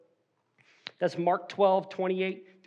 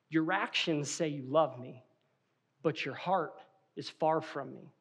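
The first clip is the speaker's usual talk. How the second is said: low, soft, grave reproach; quiet hurt; 6 semitones lower, 7 dB quieter than usual. Acted. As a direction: let it land softly, pausing before the heart. Wife gives a wounding reply.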